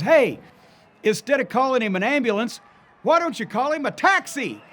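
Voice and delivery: mocking taunt. Lawler taunting on the mic.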